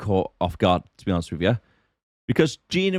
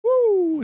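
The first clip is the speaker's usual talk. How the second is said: falsetto whoop at the heat; high woo; 3 dB louder than usual. Real, not acted. Reacting to heat.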